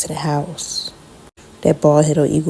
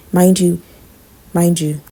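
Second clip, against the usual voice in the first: usual speaking voice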